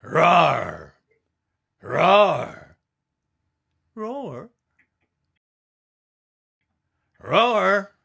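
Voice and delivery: loud drawn-out roar like a train; big booming train roar. Acted. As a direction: boom out a roaring train noise. Train roar sound effect.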